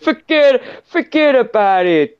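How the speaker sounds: high-pitched, drawn-out New York accent. Shown as a demonstration. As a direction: exaggerate a New Yorker's catchphrase. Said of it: "tell when someone's from New York".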